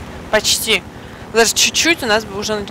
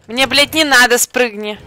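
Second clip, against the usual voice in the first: frustrated outburst; 4 semitones higher, 9 dB louder than usual. Real, not acted.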